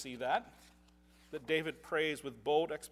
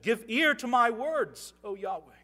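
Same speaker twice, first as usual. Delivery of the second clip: scripture reading; 4 semitones higher, 6 dB louder than usual. Acted. Reads psalm aloud.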